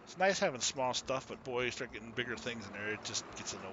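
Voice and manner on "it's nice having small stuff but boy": ordinary delivery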